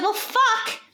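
high, deflated well, fuck; exasperated swear. Retold or imitated. Hearing the battery was destroyed, exasperated. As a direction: let it drop in disbelief.

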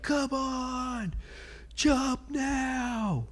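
high-pitched mock sergeant yell; drill sergeant. Sergeant ordering a scared recruit to jump, high mock yell. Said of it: "The sergeant's like".

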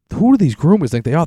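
loud, high-pitched outburst; fed up and loudly indignant; indignant rant. Real, not acted. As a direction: launch into an exasperated rant. Launches into rant about pet groomers.

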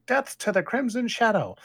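high, loud condemnation; acted yell. A narrator's reading. Man at the lectern condemning Crimson Shadow, acted yell. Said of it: "The man at the lectern yelling".

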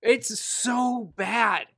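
loud, high, drawn-out complaint; emphatic exasperation. Real, not acted. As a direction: stretch every word in exasperation. Insisting the accent ruins it.

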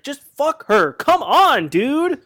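fed-up friend yelling at the screen; exasperated plea. Retold or imitated. Retelling his exasperated written plea.

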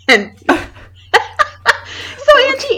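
high voice, helpless with laughter; cracking up as you go on; laughing while talking. Real, not acted. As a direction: push the words out through real laughter. Cracking up at her own story.

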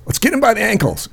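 high-pitched urgent call; retold urgent call. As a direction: imitate a frantic friend giving orders. Retold friend urgently shouting a plan.

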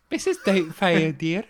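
high, loud mock-Scottish old lady; hammed-up Scottish nanny voice; Missus Doubtfire. Teasing co-host's bad accent, put-on Scottish nanny. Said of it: "You're doing like a Missus Doubtfire".

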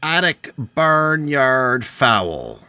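slow hammed-up commercial voice; ad read. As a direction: sell it like a cheesy local ad. Mock ad read over music.

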